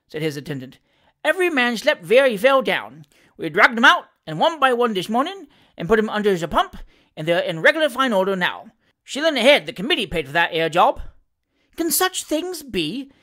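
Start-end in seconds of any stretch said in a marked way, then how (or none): 1.2-11.0 animated Cockney manservant, higher-pitched; lively dialect servant reporting with relish; Sam Weller, cheeky manservant. Acted. Valet telling his master of the election, animated Cockney. 11.8-13.1 slow, high-pitched wonderment; astonished. Acted. Master hearing of election tricks, slowly astonished. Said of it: "exclaimed the astonished Mister Pickwick".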